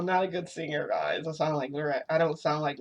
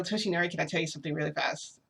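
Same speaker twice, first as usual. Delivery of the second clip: fast, rushed question; talking really fast; faster than usual. Retold or imitated. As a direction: rush it out in one breath. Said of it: "I just talk really fast".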